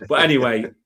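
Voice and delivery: usual speaking voice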